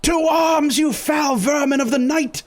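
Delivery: high, loud heroic battle shout; rally everyone with a hammed-up war cry. Lamont drawing his sword on rats, high loud battle cry. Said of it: "He goes".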